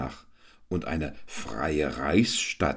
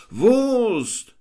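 high, loud shout; crowd shout; 7 dB louder than usual. A narrator's reading. Crowd shouts for sausage, loud and high. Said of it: "Laute Rufe".

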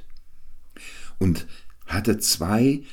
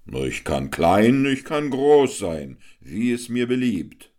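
devil announcing himself; 6 semitones higher, 6 dB louder than usual. A narrator's reading. Devil boasts.

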